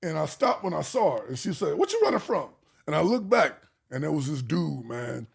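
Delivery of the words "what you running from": high-pitched retold demand; challenging mother. Mother confronting her son who runs home, challenging.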